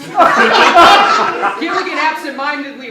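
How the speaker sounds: laughing. Real, not acted. Cracking up reading the script.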